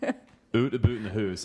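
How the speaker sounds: put-on slow, laughing caricature of a Canadian accent; overdone Canadian "oot" and "hoose" vowels; mock Canadian accent. Telling a friend what they expect, mock Canadian accent. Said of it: "is what you think is gonna come out of my mouth".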